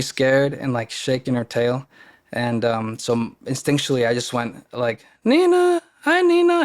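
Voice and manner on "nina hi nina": very high, loud sing-out to a dog; high-pitched dog-greeting voice. Retold or imitated. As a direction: coo the name up in a squeaky-high register. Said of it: "in this really high-pitched voice".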